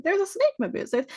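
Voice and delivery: very high, rushed toy voice; fast toy voice. Retold or imitated. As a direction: toy line sped up and stuck on repeat. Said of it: "but it's going so fast".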